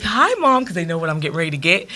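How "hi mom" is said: put-on higher, louder cheerful kids' hello; kids' loud greeting. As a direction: sing out a bright welcome to mom. Kids greeting mom loudly.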